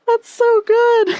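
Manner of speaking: high, loud, slow delighted shout; excited shout. Real, not acted. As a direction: shriek it with delight. Reacting to the funny message.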